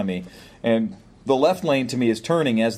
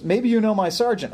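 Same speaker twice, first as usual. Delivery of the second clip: put-on young cop; 10 semitones higher than usual. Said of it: "He goes".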